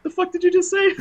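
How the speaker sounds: very high, incredulous squeak; mock disbelief. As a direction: act stunned by the insult. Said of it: "looked at him like".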